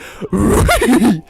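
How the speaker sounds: loud, high cartoon dog chuckle; goofy talking-dog laugh, high and loud; Scooby-Doo. Streamer ending the impression, goofy cartoon dog laugh. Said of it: "a Scooby-Doo impression".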